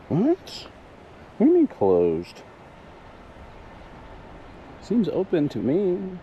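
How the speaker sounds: shocked high what, then scoffing; can't believe the trail is closed; incredulous. Real, not acted.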